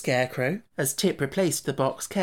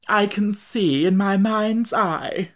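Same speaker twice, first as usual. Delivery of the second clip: slow, higher mournful musing; slow lament in a lightly changed bug voice; 6 semitones higher, 4 dB louder, slower than usual. Acted. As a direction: drag it out like a gloomy prophet. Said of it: "said he mournfully".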